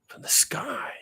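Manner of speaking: breathy stage whisper of doom; dramatic whisper. Acted. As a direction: whisper it like a spooky secret. Dramatic aside after announcement.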